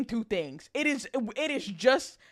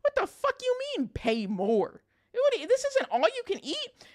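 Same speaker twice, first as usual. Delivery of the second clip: indignant disbelief; 11 semitones higher than usual. Retold or imitated. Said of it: "I was like".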